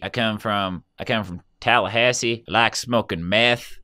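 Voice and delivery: low, put-on Southern American accent. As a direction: play a low-voiced American from Tallahassee. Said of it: "you could fake an Australian accent for views".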